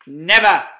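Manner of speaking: loud defiant never; firm never. Acted. Fox reassuring his wife, loud and defiant. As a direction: slam it down defiantly. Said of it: "said Mister Fox".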